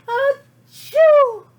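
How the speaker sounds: a loud, high sneeze that bursts out after a build-up; big sneeze. Acted. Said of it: "I sneezed a great big sneeze".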